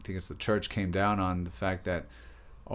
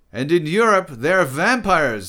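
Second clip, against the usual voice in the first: loud, high, all-knowing pronouncement; Gould; 9 semitones higher, 11 dB louder than usual. A narrator's reading. Gould adds to Derrida's bat facts, omniscient. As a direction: declare it as if you know everything. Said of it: "said Gould in an omniscient demeanor".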